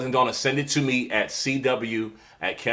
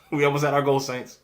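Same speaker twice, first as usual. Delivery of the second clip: fast, louder, higher preacher's appeal with a laugh; church offering appeal; 5 semitones higher, 5 dB louder than usual. Pretend. Host jokingly calling her viewers saints like a preacher. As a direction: hype it like a pastor raising the offering. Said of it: "like I'm raising a church offering".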